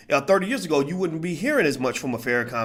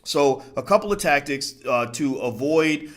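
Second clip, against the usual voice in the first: ordinary delivery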